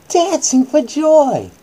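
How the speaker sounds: slow, high, loud bedtime-story reading; hammed-up children's Bible story voice; dramatic storytelling. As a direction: read it like a big dramatic bedtime tale. Repeats phrase for emphasis.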